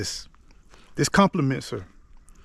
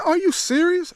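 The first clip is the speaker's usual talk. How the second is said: a much higher, astonished mock-female squeak; imitating his wife's disbelief, pitched way up; stunned wife. Wife sees the gift car.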